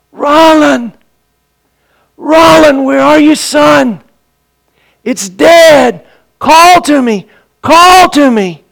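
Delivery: high, loud calling across the dark; calling out for a lost son far away; retold shout for his son. Father searching the swamp at night, shouting for his son. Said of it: "I could hear his voice".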